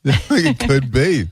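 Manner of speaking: chuckling through slow, drawn-out words; laughing. Real, not acted. Host laughing back at his co-host's imposter joke. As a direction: laugh it off, slowly.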